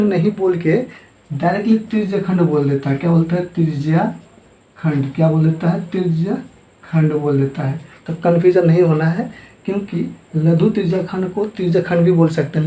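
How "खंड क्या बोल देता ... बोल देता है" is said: ordinary delivery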